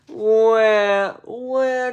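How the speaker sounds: high mock baby wail, wah wah; baby crying. Reader voices the baby crying on the plane, high mock wail. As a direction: cry like a fussing baby on a plane. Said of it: "cried the baby on the plane".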